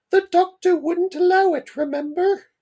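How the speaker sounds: very high, loud falsetto mum; hammed-up falsetto, far above your own pitch. Mum reminds Dad about the doctor, high falsetto mum voice. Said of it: "I was doing a voice for the mum".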